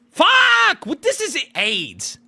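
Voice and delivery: sudden loud high curse; frustrated outburst. Real, not acted. Streamer cursing at chat after mangling the name, a loud outburst. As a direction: blurt it out in real annoyance at yourself. Flubbing the brand name again.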